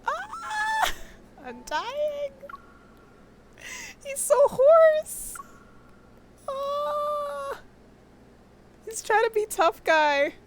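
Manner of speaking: high excited squeals; fangirl squealing. Real, not acted. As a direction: shriek with delight. Squealing at cute voice.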